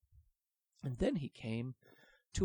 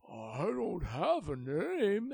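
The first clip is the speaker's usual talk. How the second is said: higher, very slow lament; sad dragon; 6 semitones higher, 6 dB louder, much slower than usual. Acted. The dragon admitting to Willy, slow and forlorn. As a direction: drag it out sadly.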